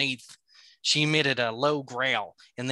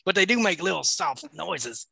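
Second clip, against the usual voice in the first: laughing protest; 6 semitones higher, 4 dB louder than usual. Real, not acted. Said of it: "She whis".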